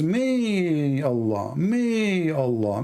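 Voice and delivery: slow, stretched mocking repetition; over enunciating. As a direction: draw out each syllable mockingly. Mocking the verse wording.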